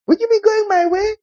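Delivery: very high squeaky dog greeting. Pretend. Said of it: "So when a dog walks up to you".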